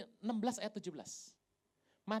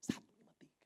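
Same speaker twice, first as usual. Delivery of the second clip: unvoiced secret whisper; a hushed tip-off to a friend; 7 dB quieter, faster than usual. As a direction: whisper the code so nobody hears. Secret code whispered to Filipus.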